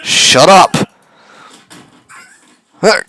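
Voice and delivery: loud high snarl; villain yells at the girl to shut up; angry shout. Acted. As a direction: bark it at her.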